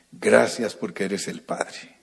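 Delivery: ordinary talk, nothing marked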